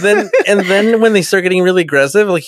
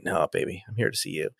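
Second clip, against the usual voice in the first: very low, very soft crooning; mock seductive Picard; 8 semitones lower, 15 dB quieter than usual. Picard murmuring to Sarek's wife, very low mock seduction.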